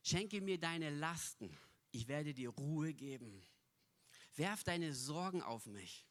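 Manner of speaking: soft reassuring voice of Jesus; gentle Jesus. Pretend. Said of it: "Und Jesus sagt".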